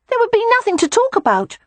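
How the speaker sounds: put-on a boy objecting to his aunt; protesting little boy. A narrator's reading. Boy argues logically.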